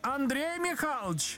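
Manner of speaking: high-pitched woman calling out; woman's yell. A narrator's reading. Wife calling from another room, high cry. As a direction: call out high as an annoyed wife. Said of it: "закричал женский голос из комнаты".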